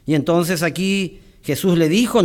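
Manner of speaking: normal talking voice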